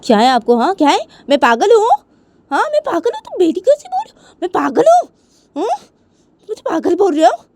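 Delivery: high, loud indignant questioning; outraged mother. Acted. (गुस्से से चिल्लाती माँ)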